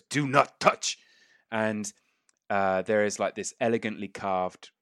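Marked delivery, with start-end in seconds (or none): 0.0-1.0 higher, louder lizard warning; firm creature command, word by word; emphatic lizardfolk order; lizardfolk character. Acted. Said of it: "again, he just goes".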